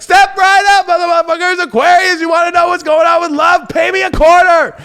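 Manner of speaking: loud, high carnival barker pitch; soapbox salesman. Acted. Salesman hawking love readings to Aquarius, loud barker shout. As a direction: holler it to a crowd like a sideshow salesman. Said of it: "I'm like a soapbox fucking salesman".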